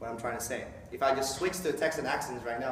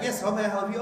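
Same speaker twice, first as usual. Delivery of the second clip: bright phone greeting; 8 semitones higher, 5 dB louder, faster than usual. Retold or imitated. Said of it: "sometimes like".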